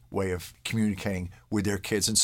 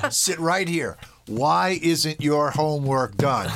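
slow, exaggerated demand, pitched up; mock-stern parent; 3 semitones higher, 4 dB louder, slower than usual. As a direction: play the parent slowly spelling out the question. Joking parent mock-questioning child.

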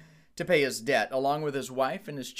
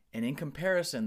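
normal talking voice

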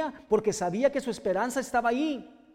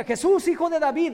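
loud, higher cry to Jesus; 7 semitones higher, 6 dB louder than usual. Retold or imitated. Blind Bartimeo calling out to Jesus, loud cry. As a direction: call out like a blind man begging. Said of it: "A pesar de que grita".

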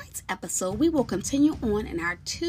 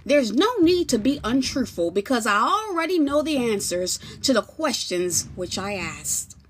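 loud, high authoritative judge; commanding judge; 4 semitones higher, 7 dB louder than usual. Acted. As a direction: address the court with authority. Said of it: "Judge Jesus then says".

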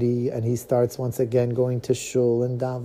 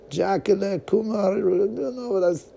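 high mocking voice; 8 semitones higher, slower than usual. Son mocks the name.

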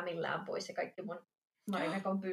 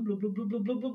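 rapid bubbling blubs, rising; imitated pumping noise. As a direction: mimic water gurgling up the pipes. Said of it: "semmoista pumppaavaa ääntä". (pulputtavan äänen matkiminen)

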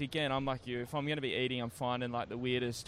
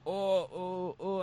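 higher, unsure oohs; mock reluctance in a raised voice; hesitant host imitation; 5 semitones higher, much slower than usual. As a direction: imitate a wary restaurant owner hesitating. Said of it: "the guy was a bit like".

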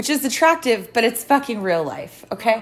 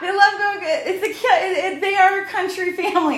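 higher, laughing as she backpedals; amused laughing talk; 7 semitones higher than usual. Real, not acted. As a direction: giggle through the excuse. Laughing defending family.

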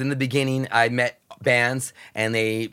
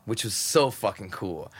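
ordinary talk

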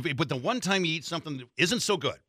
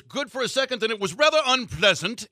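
lightly imitated South African accent, raised pitch; 6 semitones higher, 5 dB louder than usual. Rocker judging the shot for his host, imitated South African accent. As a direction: give a verdict in a put-on South African accent. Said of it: "thick, like, you know, South African accent going".

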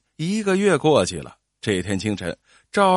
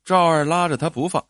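ordinary delivery, nothing marked

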